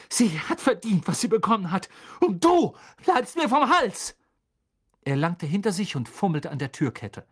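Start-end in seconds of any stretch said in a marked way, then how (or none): 0.1-4.1 angry threat. A narrator's reading. Harry threatening.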